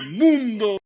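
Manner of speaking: huge, high announcer shout; Mexican announcer voice. Pretend. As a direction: belt the last word like a radio host. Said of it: "Mexican announcer voice".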